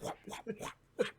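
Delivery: breathy whop sounds, soft and unvoiced; soft whip noises. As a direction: mimic the whip lightly. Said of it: "and he's just like".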